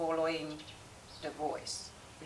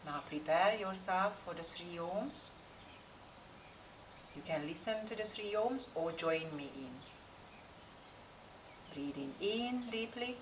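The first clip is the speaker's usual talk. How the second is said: calm, unhurried yoga meditation with long pauses; guided meditation; 7 dB quieter than usual. Real, not acted. Yoga teacher settling class before practice, guided meditation voice. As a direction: guide the class inward, leaving space between instructions.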